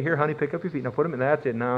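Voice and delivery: soft, tender coaxing, acted out; gentle, doting. Courting husband gently helps his honey into the car, doting. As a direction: help honey in softly and tenderly. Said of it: "really gently and say".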